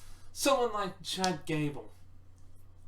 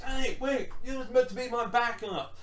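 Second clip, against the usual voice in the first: high, louder cry of betrayal; betrayed outrage; 7 semitones higher, 4 dB louder than usual. Retold or imitated. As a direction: yell it like a betrayed friend. Sami betrayed by Knoxville.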